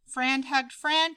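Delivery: plain reading voice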